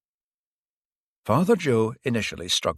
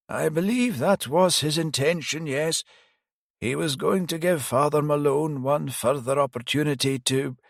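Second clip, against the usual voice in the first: put-on higher old priest voice, rueful; elderly priest; 7 semitones higher than usual. Priest admitting he failed the detective, higher pitched. Priest confirms bishop intention.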